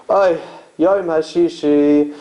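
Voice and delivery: slow, drawn-out weary oy before Kiddush; child copying a tired father's groan; kid mimicking a sigh. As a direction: sigh the "Oy" heavily, then start the blessing. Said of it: "he goes".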